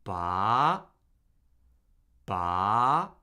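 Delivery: "Ba" rising from low to mid; second tone. Shown as a demonstration. Teacher models Mandarin second tone for learners, rising. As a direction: lift "Ba" up like a question. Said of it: "we're steadily rising up".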